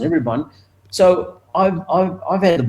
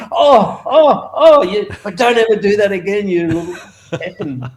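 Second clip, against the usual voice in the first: higher, loud then half-laughing scold; mock stern warning; 7 semitones higher, 3 dB louder than usual. Owner warning the rider never to do it again, mock stern. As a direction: wag a finger, jokingly.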